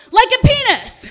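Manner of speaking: high, loud heckle from the crowd; yelled out. Retold or imitated. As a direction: bellow it from the audience. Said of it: "would yell out".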